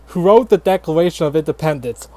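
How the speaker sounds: normal talking voice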